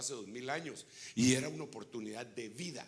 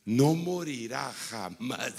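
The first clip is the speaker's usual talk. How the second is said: louder, slow declaration; slow loud proclamation; 10 dB louder, much slower than usual. Real, not acted. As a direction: proclaim each word slowly. Clímax de la cita. (proclamación lenta y fuerte)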